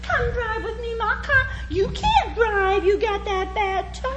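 high pleading voice through laughter; Faye pleading. Retold or imitated. Faye coaxing Ralph into her car, high pleading voice. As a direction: beg like a child who won't go alone. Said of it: "She said".

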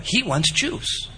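ordinary delivery

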